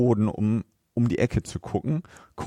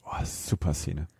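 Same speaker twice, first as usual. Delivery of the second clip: whisper; 6 semitones lower, 8 dB quieter than usual. Real, not acted. Excited aside about favourite scene.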